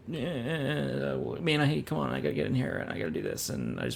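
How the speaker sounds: high, babbling mock-frantic character; frantic Shia LaBeouf. Podcaster mocking an actor's same old character, frantic. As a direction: imitate an actor stammering through a panic. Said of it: "frantic, like".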